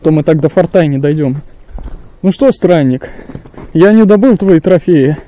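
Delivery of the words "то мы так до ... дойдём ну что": usual speaking voice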